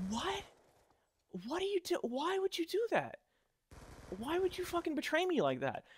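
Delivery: high, hushed, wounded disbelief; a betrayed friend asking why, barely above a breath; stunned disbelief. Retold or imitated. Betrayed friend asks Dan why, in hushed high disbelief. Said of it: "I just looked at him with a look of".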